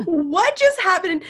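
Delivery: high, loud retold amazement; astonished. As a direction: exclaim it high, as a stunned midwife would.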